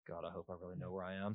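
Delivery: soft, fast worried aside; husband secretly doubting himself while driving, soft and fast; inner doubt. Retold or imitated. Husband's inner voice while refusing wife's directions, hushed. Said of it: "in my mind, I'm going".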